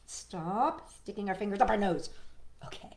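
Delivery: ordinary delivery, nothing marked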